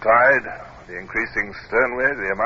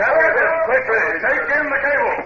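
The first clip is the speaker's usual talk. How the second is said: high, carrying commands; shouted orders. Acted. Captain ordering the crew, raised shout. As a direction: call the orders across the deck.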